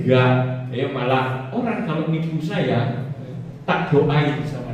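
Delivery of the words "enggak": ordinary talk, nothing marked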